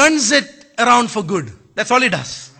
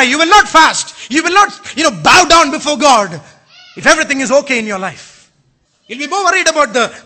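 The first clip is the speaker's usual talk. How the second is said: ordinary talk, nothing marked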